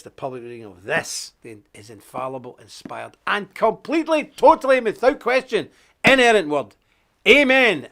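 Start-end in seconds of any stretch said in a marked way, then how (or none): 3.3-6.7 rising fervent preacher's build, louder and higher; preaching. Acted. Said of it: "It's turned into a sermon". 7.3-7.9 big amen, loud and high; preacher's amen. Acted. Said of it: "It's turned into a sermon".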